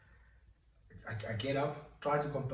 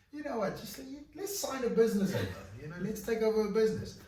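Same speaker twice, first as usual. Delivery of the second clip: mock question voice; 12 semitones higher, 6 dB louder than usual. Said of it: "a lot of people ask me".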